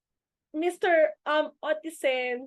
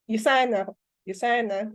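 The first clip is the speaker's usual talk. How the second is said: lowered voice; 4 semitones lower than usual. Retold or imitated. Saying the brand name with the lowered voice the mentor taught. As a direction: lower pitch, as the mentor asked. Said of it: "pinababaan niya sa akin yung voice".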